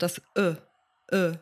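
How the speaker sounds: "Ö" as a short, neutral schwa; a relaxed, unrounded uh for "Ö"; schwa sound. Shown as a demonstration. Host showing learners the schwa sound, short neutral "Ö". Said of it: "ein gutes Beispiel dafür ist der Schwa-Laut".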